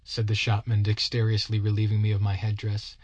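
usual speaking voice

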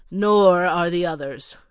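a haughty butler reeling off a list of refusals; curt butler. Acted. Butler answers the glass blower.

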